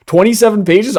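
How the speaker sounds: stunned at the length; surprised. Real, not acted. Shocked at document length.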